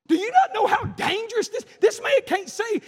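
high, loud mock protest; impassioned preaching. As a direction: plead the blind man's case in alarm. Acts out protest to Jesus.

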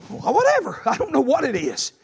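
normal talking voice

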